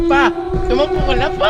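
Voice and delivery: loud, high panicked call; urgent shout. Real, not acted. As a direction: yell at dad to run now. Urging dad to run from ghost.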